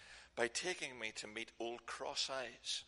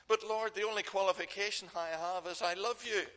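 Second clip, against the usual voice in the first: loud, high-pitched earnest protest; impassioned plea; 10 semitones higher, 8 dB louder than usual. Acted. Peter answering the Lord's call, impassioned and loud. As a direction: plead it out, voice raised high.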